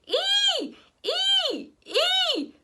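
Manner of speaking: put-on high piercing toddler screech. A narrator's reading. Toddler keeping up her screeching, high piercing screech. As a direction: keep shrieking each Ee. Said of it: "Tootsie kept it up".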